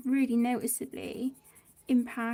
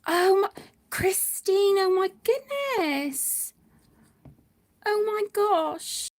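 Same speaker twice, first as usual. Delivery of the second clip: higher and louder, slow gasping oh my goodness; real surprise, drawn out and loud; overwhelmed surprise; 4 semitones higher, 6 dB louder than usual. Real, not acted. Opening a surprise gift from Christine, overwhelmed and drawn out.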